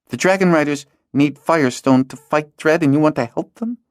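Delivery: put-on high girl's voice, incredulous question; a girl realises her friend wants to fight; dawning alarm. Hala realises his plan.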